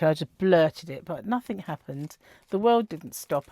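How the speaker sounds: ordinary talk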